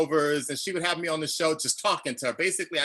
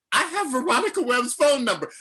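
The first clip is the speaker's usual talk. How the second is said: giddy disbelief; 7 semitones higher, 3 dB louder than usual. Retold or imitated. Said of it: "And I was like".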